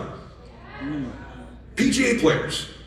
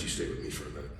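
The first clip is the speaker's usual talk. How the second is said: whisper; 16 dB quieter, much faster than usual. Retold or imitated. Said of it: "quiet aside to son".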